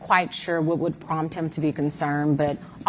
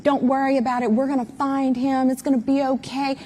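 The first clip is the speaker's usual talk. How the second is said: higher mock reassurance, quoting Warren; 7 semitones higher than usual. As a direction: parrot his calm promise back, higher and a bit sarcastic. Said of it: "this is coming from Warren".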